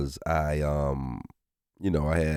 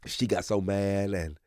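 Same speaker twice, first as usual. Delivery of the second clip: normal talking voice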